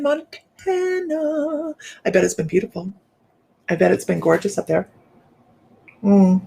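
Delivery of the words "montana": high, very slow Montana; playful drawn-out place name; sing-song. Pretend.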